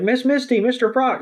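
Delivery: high mock kid voice; kid fan calling. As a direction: call the names like an eager child. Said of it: "one person's like".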